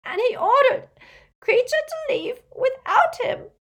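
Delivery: high, loud elf wail, sobbing hard; great rasping sobs. A narrator's reading. Elf recalls his master dragged beneath the water, sobbing wail. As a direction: cry the words out, barely understandable. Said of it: "Creature's sobs came in great rasps now".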